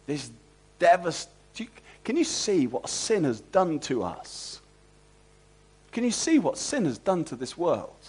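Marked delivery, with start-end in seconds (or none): none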